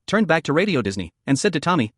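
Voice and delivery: ordinary talk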